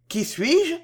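taken aback, a touch afraid; mildly alarmed disbelief; confused and frightened. A narrator's reading. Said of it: "confus et effrayé".

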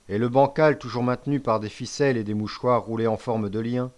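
usual speaking voice

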